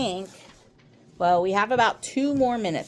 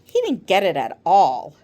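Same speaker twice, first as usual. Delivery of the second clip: ordinary delivery, nothing marked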